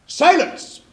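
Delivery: loud high shout for silence; commanding shout. Acted. King silencing the court with a loud shout.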